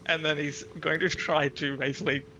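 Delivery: ordinary delivery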